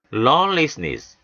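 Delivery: lawlessness drawn out slowly; slow model reading. Shown as a demonstration. Teacher reading lawlessness slowly. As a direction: spell it out slowly. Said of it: "ness 역시 명사가 되죠".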